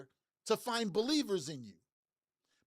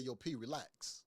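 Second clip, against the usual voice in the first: calm reassurance; 8 semitones lower, 9 dB quieter, slower than usual. Retold or imitated. Friend calms him before stage.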